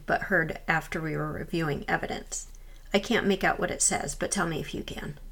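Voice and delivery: calm documentary voiceover; voiceover narration. A narrator's reading. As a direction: narrate it like a ghost-hunt recap.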